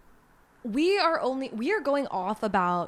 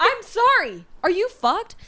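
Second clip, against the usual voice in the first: louder and higher outraged rant; 9 semitones higher, 7 dB louder than usual. Real, not acted. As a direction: blurt it out in outrage.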